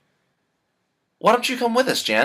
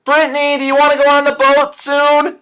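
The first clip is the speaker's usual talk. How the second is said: loud, high call offstage; 11 semitones higher, 7 dB louder than usual. Acted. Calling to Brittany offstage, raised voice. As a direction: shout up to her sister. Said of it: "Calling to her".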